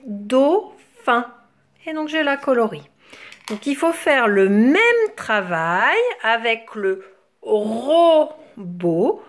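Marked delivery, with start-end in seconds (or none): 0.0-1.3 "dauphin" in two separate beats, dau... phin; split into syllables. Shown as a demonstration. Teacher showing which syllable comes first, "dauphin" split. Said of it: "En premier". 7.4-9.2 "robot" with a held ro, then bot; split into syllables. Shown as a demonstration. Teacher presenting the word to children, "robot" syllable by syllable. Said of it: "On va chercher la syllabe ro".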